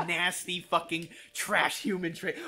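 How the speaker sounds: ordinary delivery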